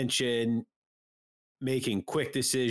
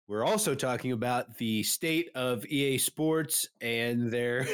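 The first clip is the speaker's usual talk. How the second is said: ordinary talk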